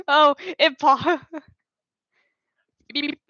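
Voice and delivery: ordinary delivery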